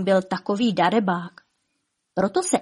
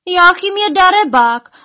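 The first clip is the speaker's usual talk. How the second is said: high, loud accusing wail; loud lament; 10 semitones higher, 8 dB louder than usual. A narrator's reading. Elves accusing the dragon, wailing loudly. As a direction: wail the complaint out, pitched way up. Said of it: "naříkali tak nahlas".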